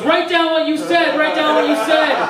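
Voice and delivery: high, loud insistent command; loud order. Retold or imitated. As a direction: demand it high and loud, twice. Dentist ordering patient.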